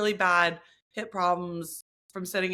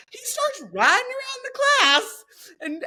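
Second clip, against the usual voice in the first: higher and slower, caught up in the story; excited; 7 dB louder, slower than usual. Real, not acted. Recounting the instructor to her friend, animated. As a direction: tell it wide-eyed and animated. Excited retelling of absurd class.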